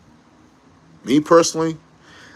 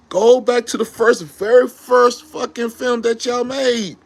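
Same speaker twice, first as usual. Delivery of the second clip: much higher and slow, pleading hard; impassioned plea; 10 semitones higher, slower than usual. Real, not acted. Fan begging the filmmakers to go back, impassioned and slow. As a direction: drag out every word like you're begging.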